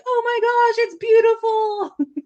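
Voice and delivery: high, drawn-out cartoon gushing; Squidward. Retelling Squidward seeing the sculpture, imitated high-pitched awe. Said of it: "And Squidward's like".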